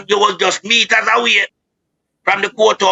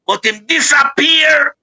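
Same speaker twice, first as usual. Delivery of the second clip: outraged; 8 semitones higher than usual. Real, not acted. Outrage at missing youth.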